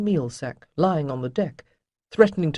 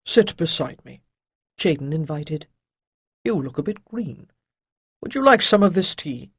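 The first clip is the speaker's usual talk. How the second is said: louder, lightly voiced old master; old sage Chayden; 3 dB louder than usual. A narrator's reading. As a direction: shake off gloom with gruff cheer. Chayden invites Tariki.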